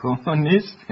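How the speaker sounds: laugh, then repeat the word in amusement; amused laughing. Acted. Woman accused by children of being communist, laughing.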